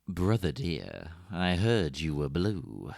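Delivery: low, slow, drawn-out line reading; sultry Ivy impression. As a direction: purr it theatrically, taking your time. Quotes Ivy's seductive line from film.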